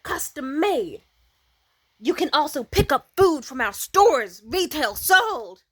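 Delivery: loud mock sales pitch. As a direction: hype up the pitch like a proud mogul. [name] pitching food delivery idea.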